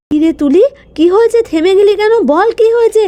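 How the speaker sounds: high, pressing questions; worried aunt urging Tuli to speak; anxious urging. Acted. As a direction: push the silent girl to speak. Anxious demand for answer.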